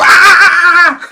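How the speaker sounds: high loud startled scream; mock girl's shriek of fear; scared shriek. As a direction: shriek in fright at a spider. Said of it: "she's downright scared".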